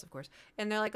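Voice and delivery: ordinary delivery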